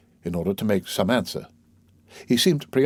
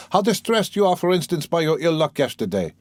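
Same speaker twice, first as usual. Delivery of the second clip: higher, eager outburst; eager cry; 6 semitones higher than usual. A narrator's reading. Lebeziatnikov crying out in sympathy to Pyotr Petrovitch, higher and eager. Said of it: "cried the simple-hearted Lebeziatnikov".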